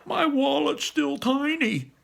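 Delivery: very high, loud, drawn-out cry; dismayed wail. Acted. Barley finding his teeny wallet, dismayed wail. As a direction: wail it at the top of your pitch.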